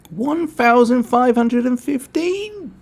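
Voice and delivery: number read out in a silly high, loud voice; odd put-on voice. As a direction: stretch the number out in a strange high voice. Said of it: "Sorry, I don't know I'm speaking like that".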